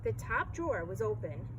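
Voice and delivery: ordinary delivery, nothing marked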